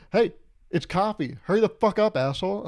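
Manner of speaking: loud impatient bark at the counter; angry customer yell. Acted. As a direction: yell at him to hurry up. Rude customer yelling behind.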